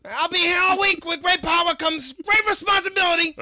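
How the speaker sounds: very high, shouted showman's farewell; hammed-up, full-volume stage exit; Kraven signing off. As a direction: belt it out like a comic leaving on top. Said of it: "And Kraven".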